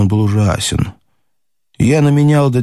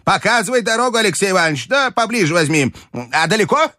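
high, urgent granny command; commanding grandmother; 11 semitones higher, faster than usual. A narrator's reading. Grandmother urging her bearers on, commanding. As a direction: hurry them along in a high old-lady voice. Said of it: "командовала бабушка".

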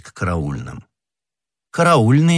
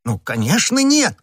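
leaps very high at the end; insist, popping up high on the last word; emphatic denial; 14 semitones higher than usual. Acted. Husband insists to the commissioner.

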